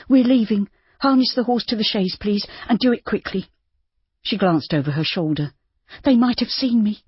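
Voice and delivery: high, urgent orders; Judy, hurried. A narrator's reading. Judy urging the stable boy, hurried and high. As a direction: hurry them along.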